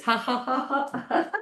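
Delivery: high, deliberate ha-ha; pretend-running laugh; exercise laughter. Shown as a demonstration. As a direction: laugh on purpose while jogging along. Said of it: "while laughing".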